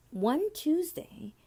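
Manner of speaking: ordinary delivery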